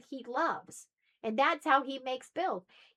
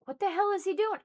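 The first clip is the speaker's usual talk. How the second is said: son's confusion; 9 semitones higher, faster than usual. Retold or imitated. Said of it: "He's like".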